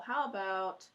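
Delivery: normal talking voice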